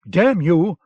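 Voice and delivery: loud furious curse at the prisoner; angry outburst. Acted. Enraged soldier curses the man who shoved him, loud angry shout.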